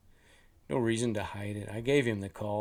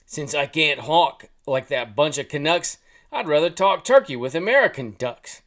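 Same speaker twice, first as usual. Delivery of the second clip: put-on loud Jersey-accented goose; 4 semitones higher, 9 dB louder than usual. A narrator's reading. American goose griping about Canadians, loud Jersey accent. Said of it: "in an accent reminiscent of Jersey".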